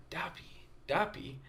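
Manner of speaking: hushed, near-whispered repetition; puzzling it over. Retold or imitated. Visitor pondering the kids' word, hushed puzzlement. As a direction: repeat it softly to yourself, trying to work it out.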